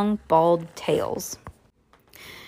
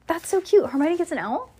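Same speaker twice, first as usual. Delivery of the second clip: high, delighted gush; excited squeal; 10 semitones higher, faster than usual. Real, not acted. Reader's excited aside.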